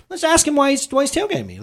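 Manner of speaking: high-pitched mock casual self-talk; feigned reasonableness. Driver talks himself into confronting a tailgater, mock reasonable. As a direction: voice the tense inner thought, pitched high. Acts out rising irritation at tailgater.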